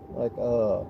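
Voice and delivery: usual speaking voice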